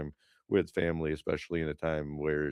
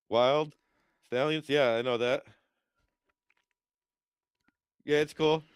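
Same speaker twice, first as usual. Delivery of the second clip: much higher, dim eager voice; high, goofy, slightly louder; mock dopey fan; 9 semitones higher, 4 dB louder than usual. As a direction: play a starstruck airhead. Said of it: "I'm like".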